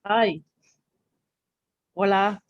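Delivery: normal talking voice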